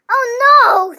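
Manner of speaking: loud comic wail; hammed-up groan of dismay; mock despair. A narrator's reading. Max dreads another request.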